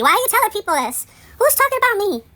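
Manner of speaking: high, loud exasperated questions; outraged. Real, not acted. Asking the gossiping neighbour why, high outraged demand. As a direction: demand an answer, fed up.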